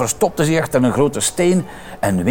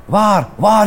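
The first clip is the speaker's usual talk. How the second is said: higher, louder eager question; children calling; 8 semitones higher, 5 dB louder than usual. Acted. Children asking where Winter is, eager calling. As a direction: ask it eagerly, all together. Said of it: "riepen de kinderen".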